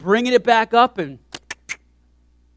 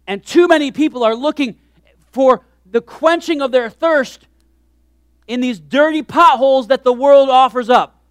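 1 fervent preaching; 5 semitones higher, 7 dB louder than usual. Acted. Preacher challenging the congregation.

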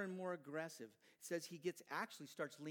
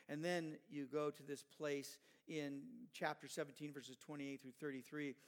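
ordinary delivery

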